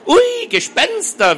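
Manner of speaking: high, drawn-out mock-spooky wail; theatrical. A narrator's reading. Girl scoffing back about castle ghosts, theatrical. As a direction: ham up a big, loud ghostly ooh. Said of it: "wieherte Hedi theatralisch".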